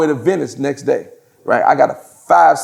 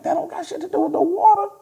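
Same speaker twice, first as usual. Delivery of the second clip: much higher, indignant retort; incredulous squeak; 13 semitones higher, 5 dB quieter than usual. Retold or imitated. Teller scoffing at the seatbelt rule, high incredulous voice. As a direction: squeak out the disbelief. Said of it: "I'm like".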